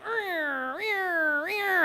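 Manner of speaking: rising mock engine whine, rear rear rear; imitated engine cranking. Imitating her car that won't start, engine noise. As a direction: mimic the car failing to start. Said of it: "And it's like".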